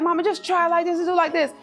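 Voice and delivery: imitating daughter. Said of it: "but she's like".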